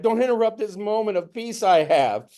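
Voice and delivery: guard the peaceful moment; a touch of irritation; protective plea. Retold or imitated. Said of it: "a part of me is going like".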